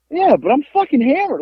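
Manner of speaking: high-pitched, retold protest; emphatic drunk admission. Drunk man making up with the biker, acted emphatic admission. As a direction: own up to it with mock exasperation.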